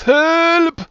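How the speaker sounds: high, loud, drawn-out scream; screamed cry for help. Acted. Game master voicing a scream from the burning farmhouse, screamed help. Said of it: "You hear a scream that says".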